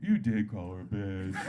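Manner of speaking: put-on low, slow drunken correction; drunk sidekick. Drunk friend contradicting his buddy from behind, drunken. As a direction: drag it out low like a stumbling drunk. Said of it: "his drunk friend stumbles up behind him".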